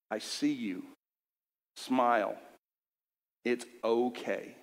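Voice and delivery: low, soft and reassuring; gentle voice of God. Retold or imitated. God soothing a weeping young man, low and soft. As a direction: say it softly, like comfort from above. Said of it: "God himself said".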